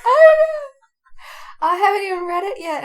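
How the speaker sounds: high, loud, crying-laugh; half sob through the words; choked up. Real, not acted. Friend moved by a fic she hasn't read, teary.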